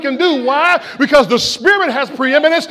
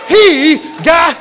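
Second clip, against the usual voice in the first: rising shout; 3 semitones higher, 4 dB louder, much slower than usual. Real, not acted. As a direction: build to a loud shout, stretching got up. Preacher building to climax shout.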